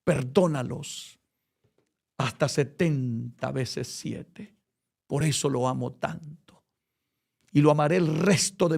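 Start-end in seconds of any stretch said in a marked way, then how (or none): 0.0-4.6 gentle Christ. Retold or imitated. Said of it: "este Cristo que dijo un día".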